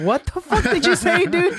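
high, cracking-up question; laughing disbelief. Real, not acted. Co-host baffled by garbled speed talk, laughing. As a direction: ask it through helpless laughter.

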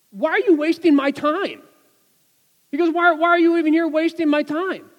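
exasperated judge demanding why he bothered coming; annoyed judge. Retold or imitated. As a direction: play the irritated judge barking at a time-waster. Annoyed judge scolds defendant.

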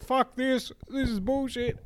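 high-pitched imitation of a sore loser; mock tantrum. Batter who just got out in cricket, mock angry outburst. Acted tantrum after getting out.

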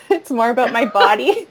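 laughing through the words; real amused laugh while speaking; giggling admission about the body. Real, not acted.

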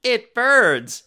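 high, loud yelp; cry of pain. Acted. Katie yelps as alien goo burns her skin. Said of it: "she cries".

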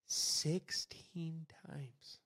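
very slow, drawn-out whisper; hushed disbelief. Real, not acted. Host marvels at the offence count, hushed and incredulous. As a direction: whisper it slowly, letting the number sink in.

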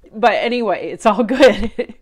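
brushing off tears. Real, not acted. Brushing off her emotion for viewers, tearful. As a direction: force cheer through real tears. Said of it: "Today is a little bit emotional for me".

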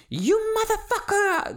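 high, loud enraged shriek; imitated scream. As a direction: scream it like a driver gone off. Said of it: "she went off screaming at me".

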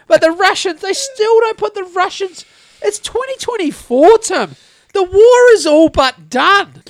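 retold heated rant, pitched high; full rant. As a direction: build into an exasperated high-pitched tirade. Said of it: "start going on a full rant".

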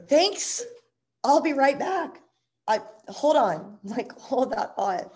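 high, bright-faced fake politeness, slowing down; overly nice excuse to slip out; polite brush-off. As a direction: stall him sweetly so you can get away. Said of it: "I didn't wanna be rude".

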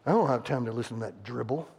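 a hint of a grumpy husband waving her off; gruff dismissive husband. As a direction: mutter it like a man brushing off his wife. Mocking husband's dismissive excuse.

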